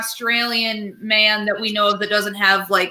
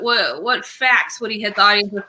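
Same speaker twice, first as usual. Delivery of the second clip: ordinary talk, nothing marked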